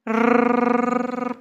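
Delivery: long rolled R like a car engine; tongue trill. Shown as a demonstration. Teacher imitating a car for tongue exercise, long rolled R. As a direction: roll the tongue in one steady, sustained motor sound. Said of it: "مثل صدای ماشین".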